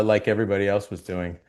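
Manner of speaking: ordinary talk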